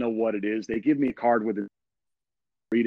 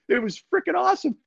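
excited rave; faster than usual. Retold or imitated. Reliving the fun day.

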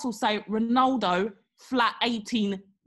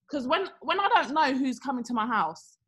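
ordinary talk

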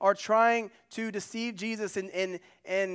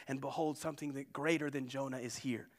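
whisper; 5 semitones lower, 9 dB quieter than usual. Real, not acted. Preacher drops voice for emphasis.

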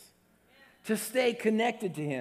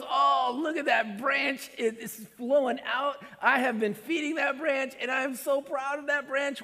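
higher, louder delighted cry over the branch; excited Jesus; 8 semitones higher, 3 dB louder than usual. Pretend. Preacher voicing an excited, proud Jesus. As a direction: gush with pride. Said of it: "He gets excited and he goes".

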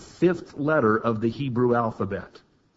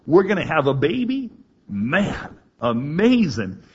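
higher and louder, laughing with joy; ecstatic amazement; 5 semitones higher, 4 dB louder than usual. Retold or imitated. Abraham marvelling at a baby at a hundred, elated. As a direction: gush like a thrilled old man. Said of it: "Abraham's laugh was".

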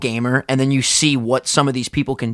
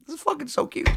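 cutesy squeal; 12 semitones higher, 7 dB quieter than usual. Retold or imitated. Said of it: "and I was like".

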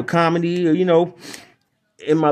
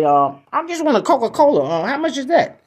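customer voice; 7 semitones higher than usual. Acted. Customer voice in joke.

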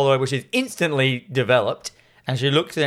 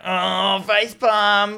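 mock cringe; 7 semitones higher, much slower than usual. Cringing at the pretentious line.

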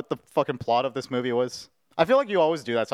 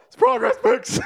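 laughing; 4 dB louder than usual. Real, not acted. Joking about the weave photo.

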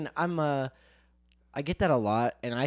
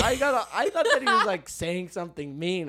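laughing quote; 6 semitones higher than usual. Retold or imitated. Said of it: "I was like".

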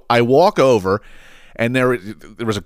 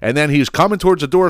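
ordinary delivery

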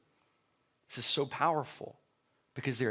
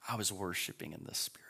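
whisper; 4 semitones lower, 6 dB quieter, faster than usual. Acted. Scripture reading, whispered line.

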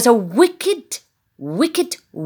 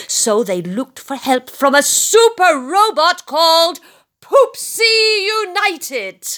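ordinary delivery, nothing marked

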